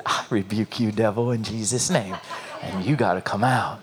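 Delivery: low, hushed, quiet rebuke; real soft whisper. Retold or imitated. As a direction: quietly command with soft authority. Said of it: "I whispered real soft to a devil".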